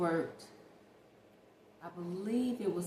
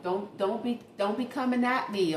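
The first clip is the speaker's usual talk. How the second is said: ordinary talk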